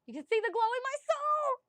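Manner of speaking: high, loud, over-the-top squeal of the line; gushing exclamation. Pretend. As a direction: belt it out in a mock swoon, way up high. Gushing about new love, exaggerated repeat.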